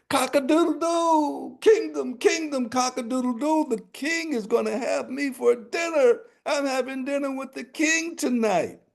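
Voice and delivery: high, ringing crow; crowing rooster. Acted. Rooster crowing from the sack to the kingdom, high and ringing.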